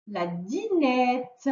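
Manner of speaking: "La dinette" very slow, stressing the open è of nette; stretched final ette. Shown as a demonstration. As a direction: draw out "dinette". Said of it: "on entend le son est à la fin". (« dinette » très lent, finale appuyée)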